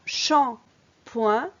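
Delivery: "shampoing" slowly split into two beats; syllable by syllable. Shown as a demonstration. Teacher showing a child where the sound falls, syllable by syllable. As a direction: break "shampoing" in two, pausing between the syllables. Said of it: "deux syllabes".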